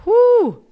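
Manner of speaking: loud, high "whoo"; heated whoop. Real, not acted. Podcaster cooling down after her rant, heated whoo. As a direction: let off steam with a high whoop. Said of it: "I'm heated".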